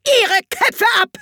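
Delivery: high, loud, drawn-out death sentence; furious royal roar; shrieked command. A narrator's reading. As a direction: shriek it slowly, like a tyrant queen. Queen orders an execution.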